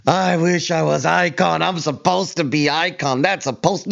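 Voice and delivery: high whiny mock voice; a hint of a whiny little kid; mocking whiny rival. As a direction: mimic him as a sulking crybaby. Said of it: "You sound like a little girl crying".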